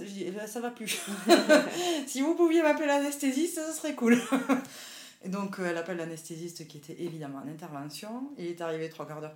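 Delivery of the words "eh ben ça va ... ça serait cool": high and loud, laughing through it. Retold or imitated. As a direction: laugh as you ask for help. Asking midwife for the anesthetist.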